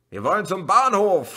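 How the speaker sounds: high, loud desperate yell; shouting. A narrator's reading. Someone in the crowd shouting at the police. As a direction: yell it over the noise. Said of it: "schrie jemand".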